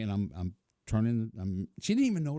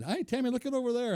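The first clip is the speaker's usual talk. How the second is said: distracting call; faster than usual. Distracting her from the sign.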